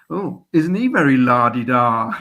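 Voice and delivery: lightly voiced elderly Sunderland fan, drawn out; imitating old Sunderland dad. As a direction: son quoting his dad's teasing remark, lightly voiced old man. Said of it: "my dad's just said".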